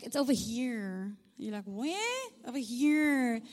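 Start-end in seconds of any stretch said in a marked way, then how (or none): 0.0-1.2 drawn-out mock American teen accent; American teenager accent. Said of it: "speak like a little American teenager". 1.6-2.3 high-pitched rising mock bafflement; puzzled parent. Mimics puzzled parent questioning son's accent. 2.5-3.4 louder, exaggerated American teen vowels; American teenager accent. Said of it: "speak like a little American teenager".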